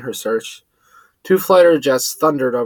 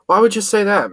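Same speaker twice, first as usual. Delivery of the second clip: yelled back; 7 semitones higher than usual. A narrator's reading. Max scolding her friend for his panic, annoyed yell. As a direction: snap back at him in annoyance. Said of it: "Max yelled back".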